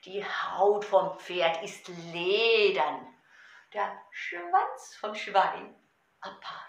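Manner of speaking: slow, expressive poem with pauses for gestures; Gedichtvortrag. Shown as a demonstration. Repeats poem for miming. (langsam und ausdrucksvoll zum Mitmachen vorgetragen)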